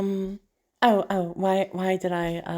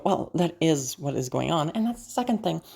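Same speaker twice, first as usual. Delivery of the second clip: ordinary delivery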